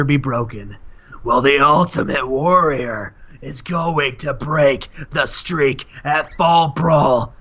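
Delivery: slow, emphatic wrestling challenge; grandiose, drawn-out promo voice; the Warrior. Acted. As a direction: punch each phrase with long pauses. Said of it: "He says".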